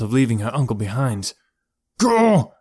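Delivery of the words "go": loud, high-pitched urgent yell; shout. A narrator's reading. Nat wrestles Reuben so Elora can flee, shouting. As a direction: yell it while struggling. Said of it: "Nat shouted as he struggled".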